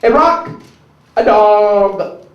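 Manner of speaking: slow, grand poetry reading; Maya Angelou poetry reading. Pretend. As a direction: read each line slow and grand. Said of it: "The real Maya Angelou".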